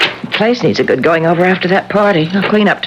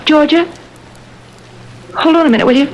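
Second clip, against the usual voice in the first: sharp gasp, then higher and urgent; startled gasp; 7 semitones higher, faster than usual. Acted. Wife on the phone spots the face in the mural, startled and urgent. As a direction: caught by a sudden fright mid-call. She sees the face reappear.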